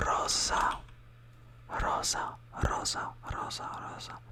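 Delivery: rosa with a quick rolled R; Italian rosa. Shown as a demonstration. Teacher modelling rosa with a rolled R. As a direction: flick the R in rosa, a quick roll. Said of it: "Now here you roll your R".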